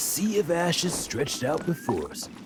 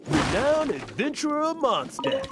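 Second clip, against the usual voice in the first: indignant boasting; 7 semitones higher than usual. Acted. Stanley indignant he's unrecognized.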